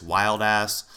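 normal talking voice

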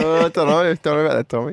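high mock voice of Jess. Teasing co-host imitating Jess, high and doubtful. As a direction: play Jess unsure, voice up high. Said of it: "Hey, Jess, what do you think".

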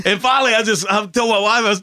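usual speaking voice

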